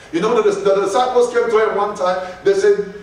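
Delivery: usual speaking voice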